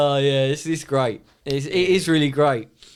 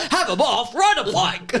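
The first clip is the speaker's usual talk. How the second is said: higher, laughing mock scorn; laughing boss imitation; 10 semitones higher than usual. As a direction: laugh through the boss's put-down. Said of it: "And he goes".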